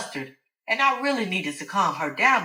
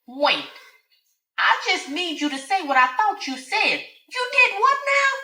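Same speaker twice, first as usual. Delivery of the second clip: high, rising disbelief; shocked Alice; 13 semitones higher than usual. Acted. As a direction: she can't believe what she heard.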